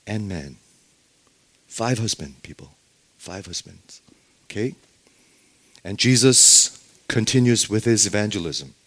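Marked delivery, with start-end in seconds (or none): none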